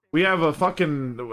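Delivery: ordinary delivery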